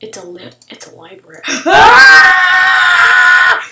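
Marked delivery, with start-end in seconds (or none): 1.6-3.6 sharp gasp into a high scream; startled gasping shriek. Real, not acted. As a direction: jump out of your skin. Startled by library.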